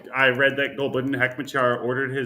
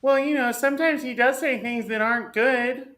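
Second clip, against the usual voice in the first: high, whiny mock liberal voice; 11 semitones higher than usual. Mocking a hand-wringing left liberal, high and whiny. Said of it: "some dipshit left liberal sit there and be like".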